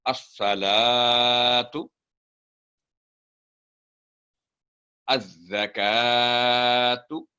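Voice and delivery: drawn-out melodic recitation; slow recitation. Shown as a demonstration. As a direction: recite slowly, holding the vowels. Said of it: "teacher models recitation".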